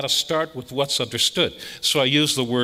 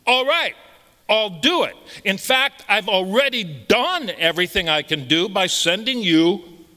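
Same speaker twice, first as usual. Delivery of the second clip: higher, kindly voice of the Lord granting mercy; the Lord answering; 7 semitones higher than usual. Acted. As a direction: speak as God reassuring his child. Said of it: "what the Lord says".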